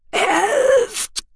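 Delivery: a high, strained, desperate cry; dying cry for help. A narrator's reading. The dying noble crying to Tech and Shallowayne for help, high and desperate. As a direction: force out one last plea as life fades.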